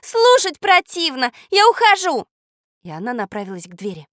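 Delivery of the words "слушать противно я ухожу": high, loud, disgusted outburst; angry shout. A narrator's reading. Girl announces she is leaving, angry shout. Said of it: "сердито закричала Катя".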